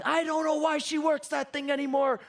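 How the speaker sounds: put-on high-pitched old Italian grandpa complaint; grumbling Sicilian grandfather. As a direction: imitate an exasperated old Sicilian man. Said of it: "Italian old Sicilian grandfather".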